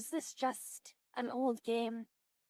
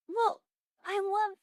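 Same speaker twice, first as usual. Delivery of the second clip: high, loud eager start; excited; 9 semitones higher, 7 dB louder than usual. Real, not acted. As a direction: burst out excitedly. Excited burst.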